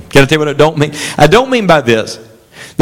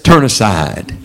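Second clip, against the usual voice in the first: voice of sin; 7 semitones lower, slower than usual. Acted. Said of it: "Sin says".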